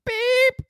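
loud, very high-pitched beep; a silly high beep as a failed sound effect; imitated beep. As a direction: squeal out a high electronic-style beep. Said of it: "It makes that sound like".

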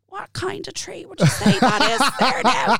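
slow, high woozy voice, laughing; dopey drugged. Retold or imitated. [name] imitating her morphine self asking about the tree, slow and high. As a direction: ask it dazed and slow. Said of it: "regular run of the mill".

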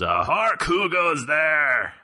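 lightly hammed-up village elder; hammy stage actor. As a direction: declaim it like a stressed elder. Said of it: "and he goes".

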